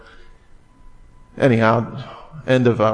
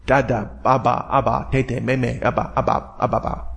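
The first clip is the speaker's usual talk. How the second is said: lightly voiced baby babble; 3 dB quieter than usual. Preacher likens Abba to baby talk, light babbling. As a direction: run the syllables together like a babbling infant. Imitating babies' babbled syllables.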